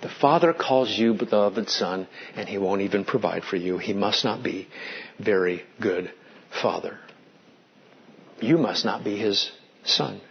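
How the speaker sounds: low, soft, insinuating tempter voice; a hint of a sly devil whispering doubt; tempter's taunt. Preacher voicing Satan to Jesus, low sly taunt.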